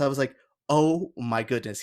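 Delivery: ordinary delivery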